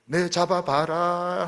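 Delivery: slow, high, sing-song tease; playful teasing. Retold or imitated. Lover teasing the beloved, slow playful tease. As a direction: dare them to catch you.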